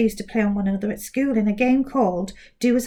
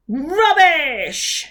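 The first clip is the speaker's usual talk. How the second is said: loud scornful shout, pitched up; 6 semitones higher, 6 dB louder than usual. Acted. Giant mouth shouts at Grisby's slip. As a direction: bark the word at them. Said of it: "shouted the mouth".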